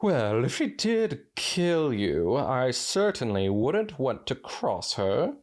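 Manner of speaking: drawn-out refined fashionista; Rarity voice. Acted. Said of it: "Rarity admitted as she fixed her hair".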